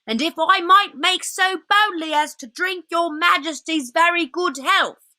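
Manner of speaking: bow and toast the queen; a hint of an anxious old fox; lightly voiced polite old fox. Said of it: "said the fox".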